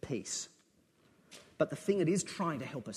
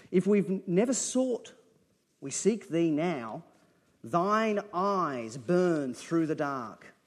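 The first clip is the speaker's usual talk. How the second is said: poem reading; 5 dB louder, much slower than usual. Acted. Preacher reciting a poem to his congregation, fervent and slow. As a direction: read the poem like a prayer rising. Reads Shillito's poem aloud.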